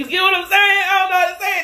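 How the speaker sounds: very high, loud and giddy; giddy outburst. Real, not acted. Reader to viewer after cracking up, giddy shout.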